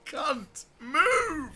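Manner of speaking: high squeal, choked by laughter; laughing exasperation. Real, not acted. Player telling Derek to move, helplessly amused. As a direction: squeeze the order out while cracking up.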